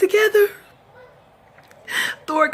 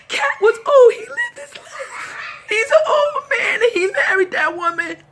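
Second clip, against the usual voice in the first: ugly cry; 6 semitones higher than usual. Real, not acted. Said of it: "I see me with the ugly cry".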